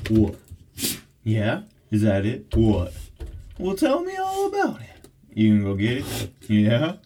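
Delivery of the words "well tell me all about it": very high coo. Real, not acted. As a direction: ask the dog sweetly, way up high. Talking to her dog.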